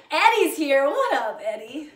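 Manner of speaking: loud, high, delighted hello to a friend; excited greeting. Real, not acted. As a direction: call out to Eddie as he joins. Greeting a friend who joined.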